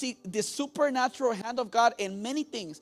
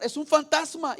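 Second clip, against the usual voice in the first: "Es un fantasma" cried higher and louder; retold shout; 6 semitones higher, 5 dB louder than usual. Disciples scream at the ghost, mock shout. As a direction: shout "fantasma" like a scared crowd.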